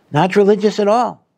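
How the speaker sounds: ordinary delivery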